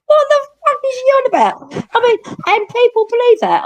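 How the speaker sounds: very high, outraged disbelief; screeching disbelief; incredulous. Real, not acted. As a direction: shriek it, baffled and scandalised. Incredulous rant about the story.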